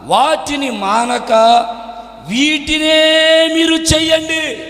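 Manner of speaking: slow, stretched phrases rising in pitch; scripture reading. Real, not acted. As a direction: intone the verse with held endings.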